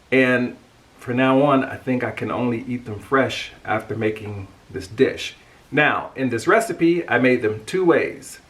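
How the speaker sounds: ordinary delivery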